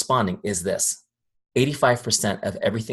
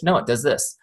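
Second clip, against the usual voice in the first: ordinary delivery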